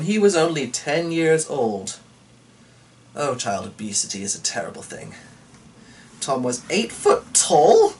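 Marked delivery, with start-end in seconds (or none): 6.2-7.9 high, slow disbelieving rise on tall; reading it out in real disbelief; surprised question. Real, not acted. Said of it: "Wha-, what?".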